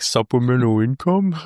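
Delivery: talking through a mouthful of soup; mumbled round a mouthful; mouth full. Retold or imitated. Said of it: "he's got his mouth full of".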